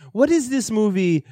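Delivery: high-pitched mock Polish accent; botched Polish accent. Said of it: "I was doing a better accent of him earlier".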